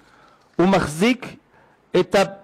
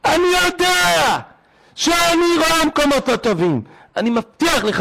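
desperate plea; 10 semitones higher than usual. Retold or imitated. Son-in-law's tearful vow.

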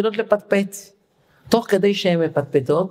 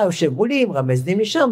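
usual speaking voice

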